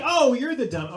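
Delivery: loud, high "oh, I get it"; sudden realization. Retold or imitated. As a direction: exclaim as the pun finally lands. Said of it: "he just got the pun".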